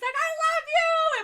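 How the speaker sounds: high, slow screamed declaration; screamed love. Retold or imitated. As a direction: yell it across the stage, stretched out. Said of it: "he came screaming".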